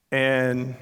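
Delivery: ordinary talk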